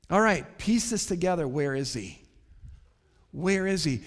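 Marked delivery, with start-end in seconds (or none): none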